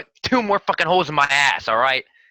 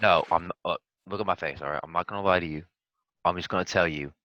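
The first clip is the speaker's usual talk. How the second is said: hushed; 11 semitones lower, 5 dB quieter than usual. Pretend. Quietly explaining plan to NPC.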